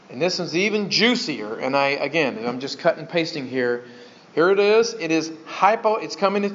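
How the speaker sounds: ordinary talk, nothing marked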